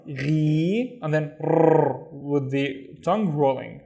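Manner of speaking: ordinary talk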